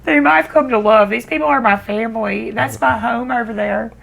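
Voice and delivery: crying through the words. Real, not acted. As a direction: voice breaking, high and tearful. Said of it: "I'm gonna need a tissue".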